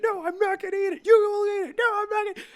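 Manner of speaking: very high, loud shrieking refusal; high-pitched yelling bickering; squealing argument. Acted. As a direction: squeal it out like a cornered cartoon. Acted cat poo argument.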